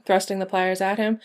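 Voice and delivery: usual speaking voice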